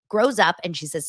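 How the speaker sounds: ordinary talk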